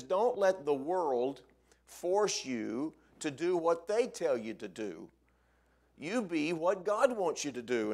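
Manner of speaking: slow, loud pulpit exhortation; emphatic, measured preacher's charge; preaching. Acted. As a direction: drive each phrase home, high and forceful. Preaches the verse in paraphrase.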